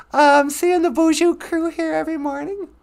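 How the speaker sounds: high puppet gushing over the viewers. Acted. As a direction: answer brightly as the puppet. Puppet answers host's question.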